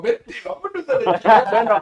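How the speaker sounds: loud, slow, high frightened urging; urgent retold flight. Telling her sister to flee the house, urgent call. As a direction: hurry her out in panic.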